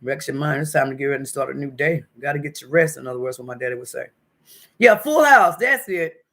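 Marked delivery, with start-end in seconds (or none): 4.8-6.2 high, loud sudden recognition; excited recall. Real, not acted. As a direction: shout it as it comes back. Suddenly remembers the show.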